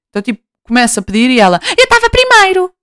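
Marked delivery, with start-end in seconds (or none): 1.8-2.7 much higher, indignant snippy retort; snippy lady. Retold or imitated. Lady in bakery queue, much higher snippy retort. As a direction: snap it high like an offended lady. Rude lady claims her turn.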